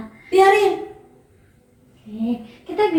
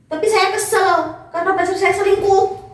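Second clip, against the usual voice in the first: higher and louder, sulky irritation; annoyed complaint; 6 semitones higher, 6 dB louder than usual. Acted. Patient complaining to the nurse about her cheating boyfriend, irritated and raised. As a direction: snap it out like a fed-up patient. Upset patient in role-play.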